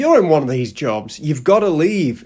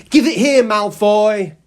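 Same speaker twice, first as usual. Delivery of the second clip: raised, loud, high-pitched order; acted demand; 7 semitones higher, 5 dB louder than usual. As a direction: call out a demand in a mock confrontation. Acts Harry confronting Malfoy.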